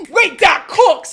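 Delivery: loud, high, drawn-out impressed reaction; Frost Prime. Retold or imitated. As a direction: exclaim it slowly in hammed-up amazement. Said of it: "Frost Prime sits there and goes".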